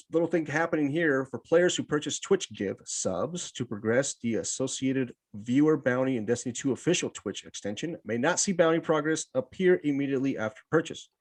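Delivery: ordinary talk, nothing marked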